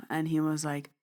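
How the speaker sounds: ordinary talk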